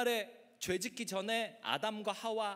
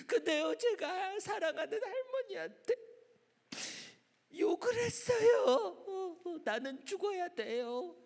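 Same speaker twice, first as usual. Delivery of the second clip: guilty schoolgirl sobbing; 9 semitones higher than usual. Acted. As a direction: sob the confession out in a girl's voice. Girl sobs out her guilt.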